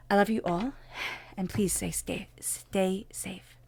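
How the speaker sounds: soft, slow goodbye, fading to a whisper; tender farewell. Real, not acted. Reader bidding Sagittarius viewers farewell, whispered and tender. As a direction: wish them safety gently, trailing off.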